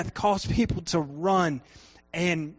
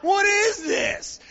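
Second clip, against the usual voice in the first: high shocked cry, then a slow high question; retold scream of disgust and shock; startled scream; 12 semitones higher, 9 dB louder, much slower than usual. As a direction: yelp in fright at the exploding wall. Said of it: "I'm like".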